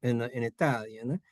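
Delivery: ordinary talk, nothing marked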